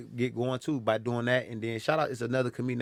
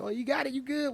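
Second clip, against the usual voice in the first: very high, cooing reassurance; high-pitched pity encouragement; mock sympathy; 13 semitones higher than usual. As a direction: imitate people humoring you in a high voice. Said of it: "like, the sympathy".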